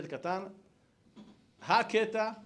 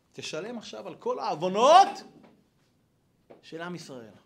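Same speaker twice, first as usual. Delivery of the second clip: acted stern demand; 3 semitones higher, 9 dB louder than usual. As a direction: demand payment, swelling on the last word. God demands payment for sins.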